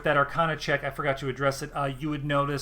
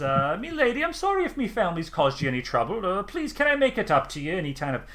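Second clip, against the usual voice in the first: high, pleading rustic farmer; high halfling pleading apologetically; apologetic halfling; 5 semitones higher than usual. Acted. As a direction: beg her pardon, hat in hand. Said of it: "grimaces and he says".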